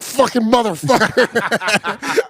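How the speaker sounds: high, slow strained swearing; mock-furious high-pitched swear; retold exasperated cursing. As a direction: draw out the curse in frustration. Retold exasperation at recurring charge.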